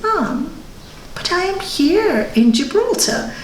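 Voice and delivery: put-on child voice. Son quoted.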